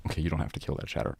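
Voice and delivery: ordinary talk, nothing marked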